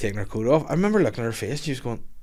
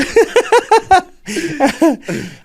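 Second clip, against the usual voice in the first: high burst of laughter; 7 dB louder than usual. Real, not acted. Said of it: "laughing at own joke".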